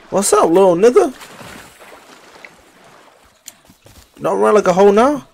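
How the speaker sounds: high-pitched taunting call-out to the enemy. Real, not acted. Taunting enemies in the game.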